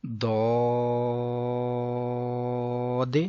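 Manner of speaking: داد with thick d, long o, bounced ending; elongated heavy D. Shown as a demonstration. Teacher recites the letter, heavy long-held داد. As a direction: thicken داد and hold it evenly. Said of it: "اس کو ہم زاد".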